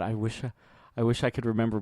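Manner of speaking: ordinary talk, nothing marked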